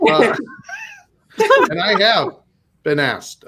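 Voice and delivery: ordinary delivery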